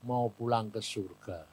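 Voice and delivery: low, soft, calm answer; quiet spirit reply. Retold or imitated. Doctor's spirit tells his friend where he goes, softly. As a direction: answer gently, sinking low.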